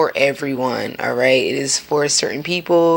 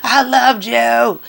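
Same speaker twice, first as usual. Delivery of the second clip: mock crying; 9 semitones higher, slower than usual. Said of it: "I was crying like".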